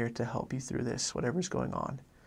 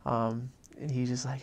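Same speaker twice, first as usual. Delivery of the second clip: ordinary delivery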